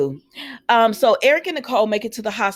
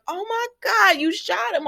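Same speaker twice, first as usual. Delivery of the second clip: high-pitched mock horror; retold shock; 10 semitones higher than usual. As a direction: imitate a shocked bystander, pitch jumping up. Retelling Ava seeing Jake shot.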